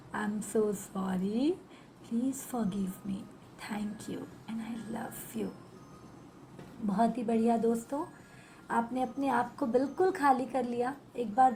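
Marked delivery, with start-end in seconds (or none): 0.1-5.5 slow, hushed whisper of the mantra; fading to a whisper. Shown as a demonstration. Closing the forgiveness mantra, whispered and fading. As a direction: let the words fade into breath. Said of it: "दिल से कहेंगे".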